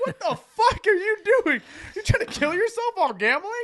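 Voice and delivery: loud, high mock disbelief; incredulous yell. Walking in on roommate in bathtub, loud incredulous outburst. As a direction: demand it in high, amused shock. Retold shock at drinking roommate.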